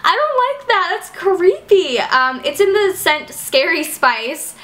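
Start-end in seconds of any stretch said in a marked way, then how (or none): 0.0-2.0 creeped out. Real, not acted. Reacting to the spider.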